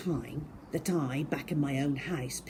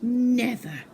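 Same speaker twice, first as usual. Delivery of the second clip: defiant never; 6 semitones higher, 6 dB louder than usual. Acted. Boy refuses.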